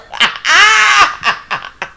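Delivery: high cackling laugh; burst of laughter. Real, not acted. Vlogger cracks up at her own roast of Kenya, laughing. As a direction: laugh it off after the rant.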